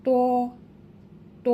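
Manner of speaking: ত held for one beat, a long full "to"; drawn-out letter name. Shown as a demonstration. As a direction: say ত thick and stretched. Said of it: "এক আলিফ পরিমাণ টেনে পড়ব". (ত এক আলিফ টেনে মোটা করে)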